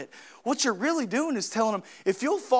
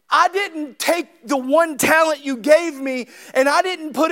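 loud, raised preaching to the congregation; full-force preaching; 6 semitones higher, 8 dB louder than usual. Acted. Pastor urging his church on prayer, preaching loudly. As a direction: drive it home from the pulpit.

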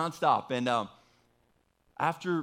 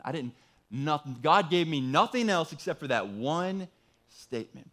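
usual speaking voice

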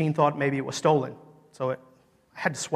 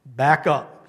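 loud, stern warning to step back; stern command; 7 dB louder, much slower than usual. Retold or imitated. Preacher retelling how he ordered a man away, stern. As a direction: order them back, firmly and loudly. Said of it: "That's what I said very sternly".

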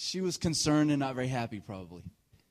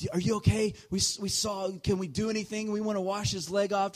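higher-pitched imitation of concerned ladies; higher mock-anxious women's voice; fussing moms; 6 semitones higher than usual. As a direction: fret over the hurt child like worried mothers. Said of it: "these ladies are running".

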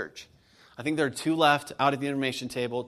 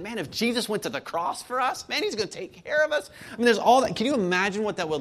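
climbing much higher and louder in fervor; rising fervor; 8 semitones higher, 5 dB louder than usual. Acted. Preacher lifting the church toward hope, excited and much higher. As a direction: preach it up to a joyful peak.

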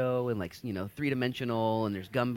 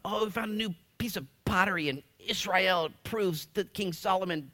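higher, sarcastic news-report voice, dragged out on the claim; mocking news quote; 6 semitones higher than usual. Pastor mocking pottery-find articles, in a higher sarcastic voice. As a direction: send up the breathless headline. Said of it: "Whenever those new articles come up".

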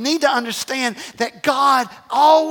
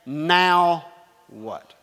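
lowered, spaced-out "Now what?"; deliberate question; 6 semitones lower than usual. Shown as a demonstration. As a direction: model "Now what?" with a pause between words. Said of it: "Now I want you to say".

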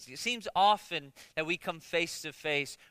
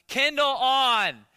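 loud, slow and higher, spoken to a device; loud slow command; 6 semitones higher, 8 dB louder, much slower than usual. Retold or imitated. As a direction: belt it out slowly so the machine hears. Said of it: "you gotta say it real loud".

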